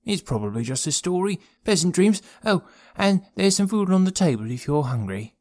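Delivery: high, cheery character voice; lilting, high-pitched cheer; cheerful. A narrator's reading. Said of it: "said Wisteria cheerily".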